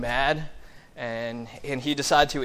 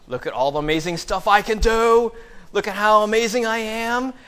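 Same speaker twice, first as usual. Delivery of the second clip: loud, higher mock bragging; acted boasting; 5 semitones higher, 4 dB louder than usual. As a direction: show off like a self-styled super apostle. Super apostles boasting, acted.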